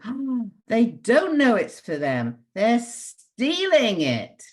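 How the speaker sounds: put-on high, slow, wide-eyed little girl; child's exclamation. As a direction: act out your child self, amazed. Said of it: "And then I said".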